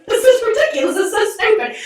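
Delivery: high, laughing outrage; laughing exasperation. Real, not acted. Laughing at her own rant to a friend, high and amused. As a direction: laugh at how absurd it is.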